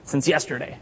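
lightly voiced grumbling camper; mock grumbling. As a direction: play a petty camper grumbling. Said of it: "you should start grumbling, like".

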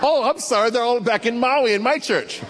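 laughing. Real, not acted. Teasing joke about missing congregation.

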